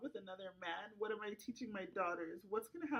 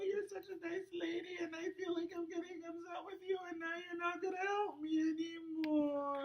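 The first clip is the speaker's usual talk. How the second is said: tearful and upset; 7 semitones higher, 5 dB louder than usual. Acted. Client upset, near tears.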